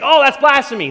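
high, loud scandalised cry; outraged cry. Retold or imitated. Pharisees reacting to Jesus's claim, high scandalised outrage. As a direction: gasp it out in outrage. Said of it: "And they're saying".

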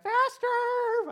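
wife urging her husband to outrun a tornado, shouting; retold yell. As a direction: yell it like the truck must outrun the storm. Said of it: "And she's like".

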